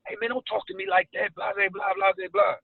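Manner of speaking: high-pitched, high-energy mock rant; hyped-up hothead. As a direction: imitate someone worked up and confrontational. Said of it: "like, real high energy".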